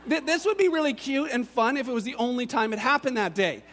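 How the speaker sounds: ordinary delivery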